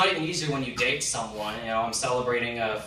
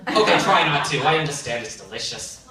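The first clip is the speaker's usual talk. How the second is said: usual speaking voice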